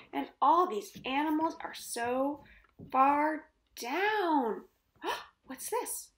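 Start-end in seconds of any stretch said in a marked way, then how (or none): 5.0-6.1 gasped, soft what's this; gasping surprise. A narrator's reading. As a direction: catch your breath, then ask. Storyteller gasps at surprise.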